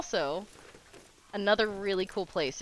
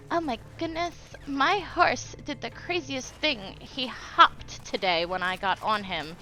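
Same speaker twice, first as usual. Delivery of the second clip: higher-pitched mock hoity-toity voice; hoity-toity rider; 5 semitones higher than usual. Imitating local horse people bragging about a horse, mock hoity-toity. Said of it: "hoity-toity people in my area".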